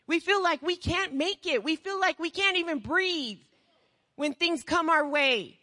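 normal talking voice

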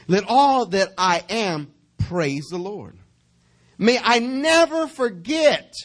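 loud, high and slow, each word drawn out; full-force preaching. Real, not acted. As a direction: proclaim the verse at full voice.

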